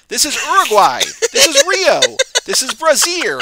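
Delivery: hammed-up flubbed takes. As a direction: belt out the botched takes like a frustrated actor. Hammed-up blooper takes of the line.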